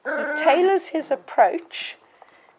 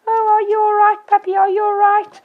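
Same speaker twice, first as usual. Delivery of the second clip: high, loud cooing worry; imitated over-concerned owner, high and gushing; mock anxious fussing; 10 semitones higher, 11 dB louder than usual. Shown as a demonstration. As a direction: fuss over the puppy, pitched way up. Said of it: "in a very".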